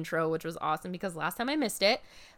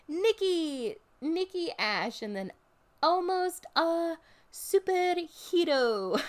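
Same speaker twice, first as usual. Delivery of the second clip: much higher, slow Scottish catchphrase; Scottish accent; 10 semitones higher, slower than usual. Retold or imitated. Fan imitates Nikki Ash's intro in a high slow Scottish accent. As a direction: do her cute Scottish accent, stretched out. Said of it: "she has a really cute accent".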